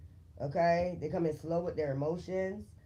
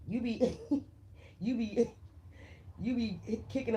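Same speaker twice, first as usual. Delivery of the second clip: giggles breaking up the sentence; laughing; 4 semitones higher than usual. Real, not acted. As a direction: restart the phrase through laughter. Laughs at her own image.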